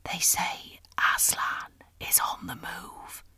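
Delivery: slow, hushed secret whisper; low whisper. A narrator's reading. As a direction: breathe the news like a sacred secret. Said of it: "in a low whisper".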